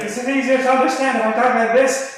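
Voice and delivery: high, loud put-on Irish brogue; fake Irish accent. Shown as a demonstration. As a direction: ask it in an overdone Irish accent. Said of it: "fake Irish accent".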